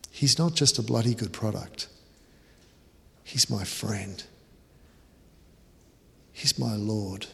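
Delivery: soft, heartfelt confession; soft, sincere tribute to his Lord. Acted. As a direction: speak from the heart, quietly.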